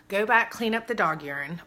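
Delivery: usual speaking voice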